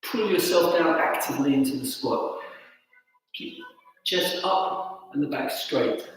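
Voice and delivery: calm exercise cues. Acted. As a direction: guide the breath gently. Guides breathing and stretches.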